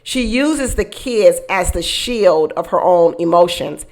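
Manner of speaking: ordinary talk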